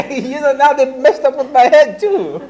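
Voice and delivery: high, loud laughing complaint; real laughter through the words. Real, not acted. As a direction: laugh it out, mock outraged. Laughing at being confused himself.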